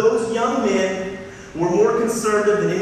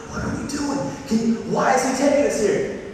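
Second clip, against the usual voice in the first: higher, falling puzzled question; puzzled disciple; 6 semitones higher than usual. Acted. Disciple baffled, asking why Jesus brought them. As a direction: sound baffled at being led there.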